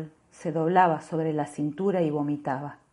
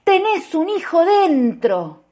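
high, loud, slow yell; loud desperate shout; 11 semitones higher, 7 dB louder, slower than usual. Retold or imitated. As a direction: yell it at her, pleading. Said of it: "Y yo le grité".

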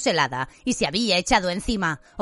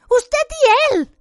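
very high, loud and drawn out; horrified, piercing shout; shrieked accusation; 7 dB louder, slower than usual. A narrator's reading.

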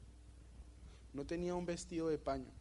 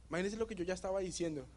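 normal talking voice

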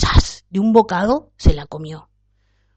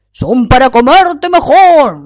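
loud, slow, booming wolf threat; big bad wolf pounces with a loud, slow roar; menacing wolf roar; 6 semitones higher, 13 dB louder, slower than usual. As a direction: boom it out to scare her. Wolf reveals himself, big finish.

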